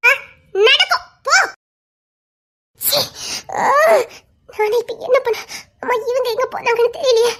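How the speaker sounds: normal talking voice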